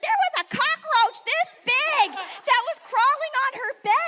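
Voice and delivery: high, loud, breathless alarm; excited panic. Retold or imitated. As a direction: blurt it out, freaked. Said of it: "Emily's like".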